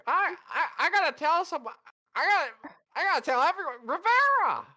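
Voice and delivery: excited shouting. Acted. Gleeful gossip calling out to Rivera.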